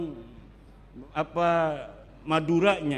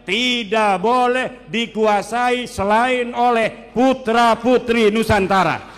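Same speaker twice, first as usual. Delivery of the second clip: slow, building to a much louder, higher climax; fiery preaching; 3 semitones higher, 7 dB louder, slower than usual. Real, not acted. Preacher rousing the congregation about Nusantara, slow and swelling loud. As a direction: swell into a rousing patriotic call.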